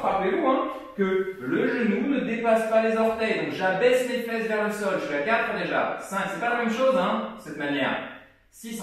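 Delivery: normal talking voice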